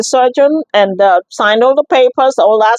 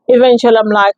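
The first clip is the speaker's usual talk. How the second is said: ordinary talk, nothing marked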